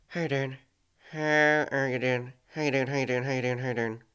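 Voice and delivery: muttered practice of Northern Irish accent. Shown as a demonstration. Reader warming up before the friend's line, practising Northern Irish accent. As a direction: try out a Northern Irish lilt. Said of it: "do this in a Northern Irish accent".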